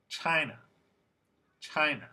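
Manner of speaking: China with a long first syllable, CHI-na; lengthened stressed vowel. Shown as a demonstration. Teacher modelling vowel length for students, stressed first syllable in China. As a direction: stretch the first vowel of China, short ending. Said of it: "These sounds should sound a little longer".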